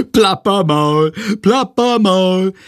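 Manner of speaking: high, slow drawn-out mocking chant; laughing taunt. A narrator's reading. Friedrich teasing as he leaves class, laughing chant. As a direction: jeer it through laughter, stretching each word. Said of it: "hält sich den Bauch vor Lachen".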